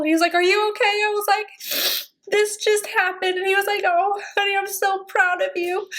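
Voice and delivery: crying through a happy memory, pitch lifted; tearful. Real, not acted. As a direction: voice high and wobbling with real tears. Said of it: "I'm going to stop crying".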